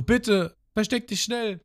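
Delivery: pleading Yosako. Retold or imitated. Yosako begs Ruffy to hide, high and urgent. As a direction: beg him to hide before it's too late. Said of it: "Und auch Yosako".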